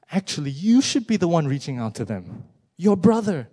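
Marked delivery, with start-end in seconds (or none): 2.8-3.5 emphatic call. Real, not acted. Preacher drives the point home.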